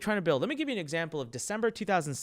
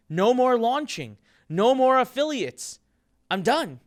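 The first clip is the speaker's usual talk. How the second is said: louder, slow, firm no more; retold decisive outburst, louder and slower; emphatic declaration; 6 semitones higher, 6 dB louder, slower than usual. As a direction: hammer each no more home. Declaring he's done.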